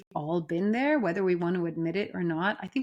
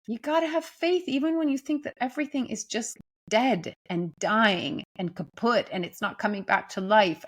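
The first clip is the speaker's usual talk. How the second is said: usual speaking voice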